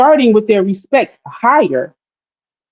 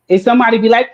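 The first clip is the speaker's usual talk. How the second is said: ordinary talk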